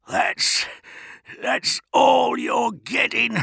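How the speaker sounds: high, slow, strained gasps; wheezing defiance. A narrator's reading. Tortured prisoner refusing his interrogator, wheezing. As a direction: squeeze the words out breathlessly. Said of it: "he wheezed".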